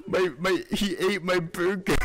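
high, wailing imitation of a crying kid; mock crying. Retelling his childhood meltdown to friends, mock crying. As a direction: blubber it out in mock tears. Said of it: "I started to cry".

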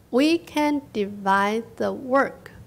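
slow, evenly spaced words; teacher modelling a sentence for learners; slow model reading. Shown as a demonstration. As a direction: read it out slowly for repeating. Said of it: "跟我念哦".

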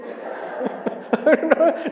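breathy high laugh at himself; a short unvoiced chuckle. Real, not acted. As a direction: let out an amused laugh. Laughing at his own fear.

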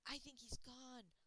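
plain reading voice

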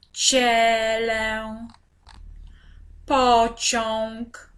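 slow, even word-by-word reading for beginners; drawn-out syllable reading. Shown as a demonstration. Teacher reads example words to young learners, drawn-out syllables. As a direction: stretch each syllable on a level, sustained note.

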